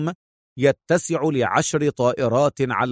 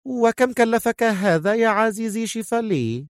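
raised feminine character voice, keenly asking; exclaiming woman; 9 semitones higher than usual. A narrator's reading. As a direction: exclaim it up high without shouting. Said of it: "صاحت سونيا".

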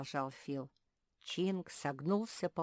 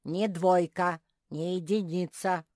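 loud, proud assertion; 3 semitones higher, 10 dB louder than usual. A narrator's reading. Bear cub defending his grade, proud. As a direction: state it with loud pride. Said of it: "гордо сказал медвежонок".

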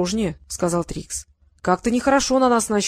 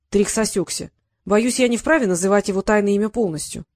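usual speaking voice

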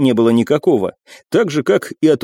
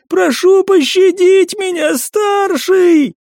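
much higher, slow pleading cry; terrified begging shout; much slower than usual. Acted. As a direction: beg for your life. Servant begs for mercy.

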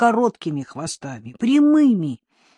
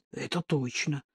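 low, hushed wary thought; soft, cautious aside; 3 semitones lower, 10 dB quieter than usual. A narrator's reading. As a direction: think it guardedly, under your breath. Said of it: "подумал я настороженно".

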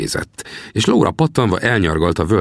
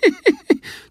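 high teasing cackle around the words; mocking laugh. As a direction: laugh down at the greenhorn. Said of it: "Csúfolódott Sam".

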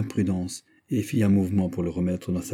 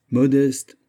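loud, higher-pitched cry of rapture; transporté; 5 semitones higher, 6 dB louder than usual. A narrator's reading. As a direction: exclaim it, carried away with admiration. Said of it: "s'écria D'Artagnan transporté". (s'écrier avec transport)

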